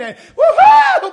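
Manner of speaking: high shouted woo-hoo of joy; joyful whoop. Acted. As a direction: let out a revival whoop.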